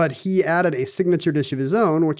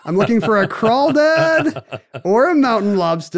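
higher, louder personal ad voice; dating ad voice; 8 semitones higher, 6 dB louder than usual. Pretend. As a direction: read it like a lonely hearts ad. Mock dating ad.

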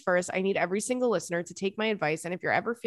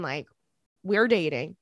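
ordinary talk

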